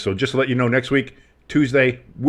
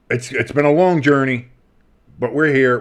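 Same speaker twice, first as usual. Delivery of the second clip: usual speaking voice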